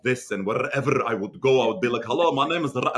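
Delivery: hammed-up Middle Eastern accent with hard consonants; old thick Middle Eastern accent, rolled r's; strong Middle Eastern accent. Shown as a demonstration. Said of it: "when I first landed to America, everything was so strong with my consonants".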